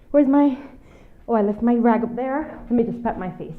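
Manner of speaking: normal talking voice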